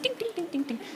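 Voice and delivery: tiny, soft tinkling dings; ping lightly, very softly; imitated heel clicks. Comic imitates heels on tiles, dainty quiet dings. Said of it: "I hear her little high heels".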